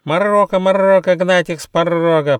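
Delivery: very high, loud squawking bird; shrill bird chant with rolled r; piercing shriek. A narrator's reading. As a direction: screech it like a hysterical kookaburra. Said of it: "пронзительно закричала синекрылая птица Кукабурра".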